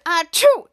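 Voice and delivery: loud mock sneeze; exaggerated acted sneeze. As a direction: fake a big sneeze. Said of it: "both antivirus sneezed at them".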